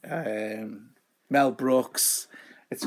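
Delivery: usual speaking voice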